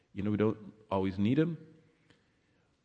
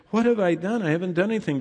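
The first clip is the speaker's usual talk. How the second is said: higher, pleading protest of innocence; David protesting; 8 semitones higher, 3 dB louder, faster than usual. Acted. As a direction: plead your innocence to God.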